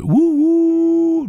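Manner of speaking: high, loud steam-whistle hoot; long high loud train whistle wouhou. A narrator's reading.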